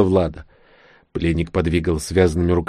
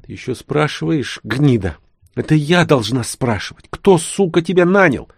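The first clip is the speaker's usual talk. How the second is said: higher, furious hiss at the prisoner; hissed threat; 7 semitones higher than usual. Acted. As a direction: spit the words at him. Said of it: "Влада наклонилась над ним и прошипела".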